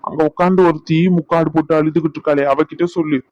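ordinary delivery

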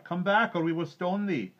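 high, loud threatening yell; high-pitched menacing call; crowd shouting. A narrator's reading. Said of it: "shouted the crowd".

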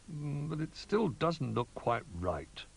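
slow, high musing; doubtful Duck. A narrator's reading. Duck judging the decorated tree, doubtful. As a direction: draw it out with mild doubt.

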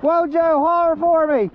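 high-pitched holler to a friend; calling out. Real, not acted. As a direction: call across for a favor.

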